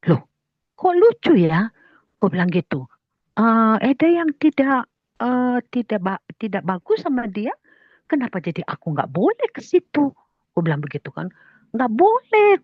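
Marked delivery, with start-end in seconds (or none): 0.1-1.7 high, loud re-enacted bafflement; puzzled surprise. Teller finds in-law's reason odd, puzzled. Acted puzzled reply to in-law. 3.4-10.1 high-pitched re-enacted objection; protesting. Teller objects to in-law's ban, protesting. 11.7-12.6 high, loud re-enacted refusal; admonishing. Teller tells in-law it is wrong, admonishing.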